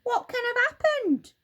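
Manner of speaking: put-on very high, loud old-woman cry of alarm; shrill old woman panicking, pitched high; Missus Twit alarmed. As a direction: Missus Twit staring at her stick, alarmed. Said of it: "Missus Twit said".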